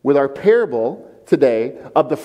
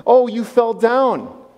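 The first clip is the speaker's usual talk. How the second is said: mock sugary concern; 7 semitones higher, slower than usual. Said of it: "how Minnesota nice handles that".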